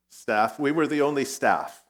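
ordinary talk

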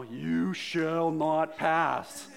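loud, very slow, booming wizard; Gandalf voice. Retold or imitated. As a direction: boom it out like Gandalf facing the Balrog. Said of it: "think of this in almost that Gandalf voice".

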